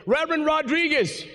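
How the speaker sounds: hint of a reporter calling on him; reporter's question. Reporter voiced in retold press conference.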